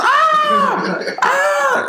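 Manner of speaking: two very high drawn-out cries; hammed-up falsetto "ah! ah!"; mock climax cries. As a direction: wail it high, imitating the comedian. Acting out the bit's climax.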